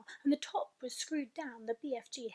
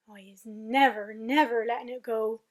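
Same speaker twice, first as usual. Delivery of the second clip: loud vow; 9 dB louder than usual. Acted. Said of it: "the B F G cried".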